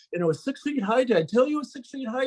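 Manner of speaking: high, rushed and tumbling over words; breathless excited kid. Retold or imitated. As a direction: blurt it out, wide-eyed. Breathless kid telling father.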